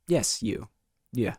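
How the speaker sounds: usual speaking voice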